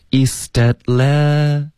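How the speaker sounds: East Atla- slowly linked as ees-dat-la; linked, dropped T. Shown as a demonstration. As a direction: link East Atla- and hold the last syllable. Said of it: "at的t也省略". (East Atla-慢速连读示范)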